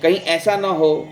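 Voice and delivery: plain reading voice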